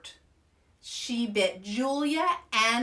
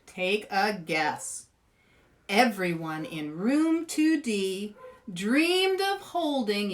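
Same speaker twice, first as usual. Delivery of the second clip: ordinary delivery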